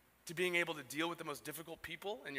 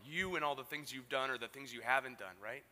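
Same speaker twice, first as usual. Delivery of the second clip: ordinary talk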